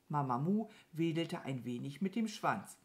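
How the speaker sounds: plain reading voice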